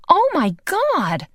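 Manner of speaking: high, slow, appalled cry; drawn-out shocked exclamation; horrified. Acted. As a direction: recoil in disgust. Horrified at what he implies.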